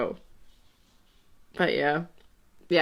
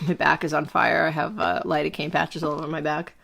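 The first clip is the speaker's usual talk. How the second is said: ordinary talk, nothing marked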